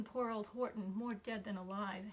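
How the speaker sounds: plain reading voice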